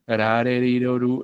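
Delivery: "arareriroru" with quick single taps; light Spanish r on each syllable of "arareriroru"; Spanish tapped R. Shown as a demonstration. Teacher shows the Spanish sound that Americans use for T, tapped R. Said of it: "hacer la R del español".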